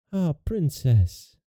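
understated tearful sigh of dismay; mild weeping queen. A narrator's reading. Weeping queen addresses her daughter, mildly tearful.

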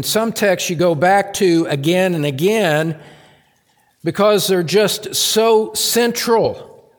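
fervent sermon voice building to a shout; preaching at full force. Acted. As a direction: preach it out to the back row. Impassioned point about central texts.

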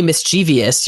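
"mischievous" as mis-CHEE-vee-us; extra I. Shown as a demonstration. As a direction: say "mischievous" in four syllables, stressing CHEE. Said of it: "adding an I that isn't there".

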